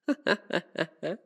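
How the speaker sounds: light, rippling sinister chuckle; wicked, amused vampire chuckle; villainous laugh. Acted. Vampire enjoys the guest's dread.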